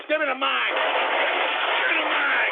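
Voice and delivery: high yell, last one drawn out; angry demand. Real, not acted. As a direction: holler for the mic. Host demands mic mid rant.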